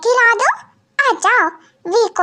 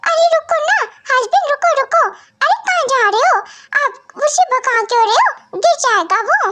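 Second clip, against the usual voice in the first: higher, urgent calling after him; calling out stop; 6 semitones higher than usual. Acted. Wife calls her husband to stop chasing their son. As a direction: call him back, alarmed.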